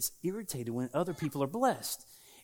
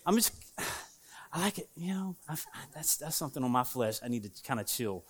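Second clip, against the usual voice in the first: normal talking voice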